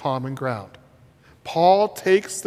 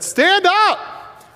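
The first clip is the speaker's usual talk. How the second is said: high, raised command; doctor's command; 12 semitones higher, 3 dB louder than usual. Retold or imitated. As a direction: order him up. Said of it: "if that doctor would have said".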